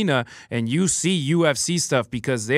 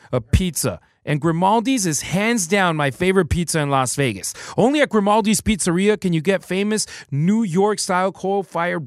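ad read; 5 semitones higher than usual. Acted. Host reads pizza ad.

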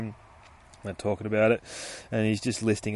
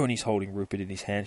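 normal talking voice

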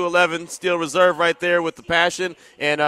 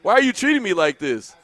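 car scolding him; 5 semitones higher than usual. Retold or imitated. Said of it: "My car was angry at me".

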